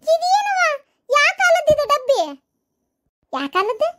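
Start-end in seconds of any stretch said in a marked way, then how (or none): 0.0-0.6 much higher, louder outraged cry; shocked outburst. Acted. Daughter sees the old lunchbox. 1.1-2.2 higher, louder scornful disbelief, stress on ಕಾಲದ್ದು. Acted. Mocking the old lunchbox.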